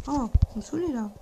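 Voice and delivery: ordinary talk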